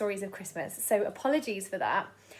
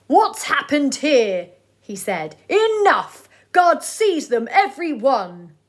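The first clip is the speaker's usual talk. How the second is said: furious mayor; 3 semitones higher, 9 dB louder, slower than usual. Acted.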